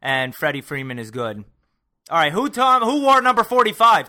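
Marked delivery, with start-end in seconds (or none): 2.1-4.1 high and loud, pressing for an answer; impatient loud call. Real, not acted. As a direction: call him out to get on with it. Demanding answer after stalling.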